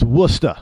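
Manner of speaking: Worcester as "WUSS-tuh"; two syllables in Worcester, no R; New England pronunciation. Shown as a demonstration. Correcting outsiders as locals do, New England Worcester. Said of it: "that's the way they pronounce it".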